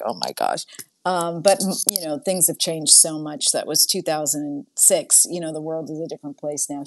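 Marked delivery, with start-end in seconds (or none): 0.0-0.6 low, soft, sinking oh my gosh; a hushed, crestfallen dawning; deflated realization. Retold or imitated. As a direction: let the air go out of it, low and quiet. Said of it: "And I was like".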